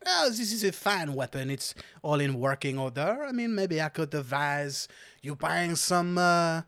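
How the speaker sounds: put-on French-accented expert appraisal. Seller advising customer on crossbow, French accent. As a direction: advise as a theatrical French stallholder. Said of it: "I will do this accent".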